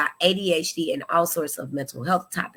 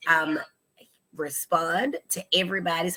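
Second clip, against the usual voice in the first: ordinary talk, nothing marked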